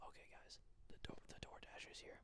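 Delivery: hushed whisper. Real, not acted. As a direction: whisper so nobody hears. Hushing while delivery arrives.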